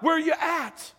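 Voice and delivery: high-pitched rescuer's call; yelling out. Acted. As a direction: call out urgently to someone trapped in a fire. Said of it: "they're yelling out".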